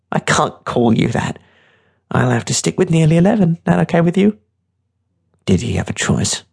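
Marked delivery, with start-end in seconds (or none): 0.1-4.4 higher, offhand decision; lightly voiced tough girl, teasing. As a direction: settle on a nickname for the kid.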